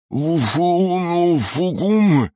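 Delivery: high, panicked mumbling behind a gag; muffled through gag. Acted. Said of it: "неразборчиво пробормотал школьник сквозь кляп".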